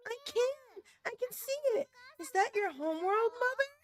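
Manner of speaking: put-on high, wide-eyed little kid asking his mother; small child voice. Child asking mother about the stars, high curious voice. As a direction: pitch it up like a curious young boy.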